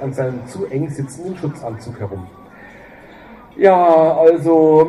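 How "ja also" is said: loud hesitant. Acted. Guck hesitates before odd answer.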